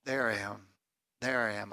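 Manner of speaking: soft, low, tender declaration; hushed reassurance. Acted. Preacher voicing Jesus to confused disciples, hushed. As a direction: say it gently, dropping low.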